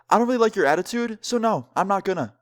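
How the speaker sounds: high-pitched, sassy retold brush-off; quick, high mock sass; mock snotty refusal. Voicing the worker's snotty refusal.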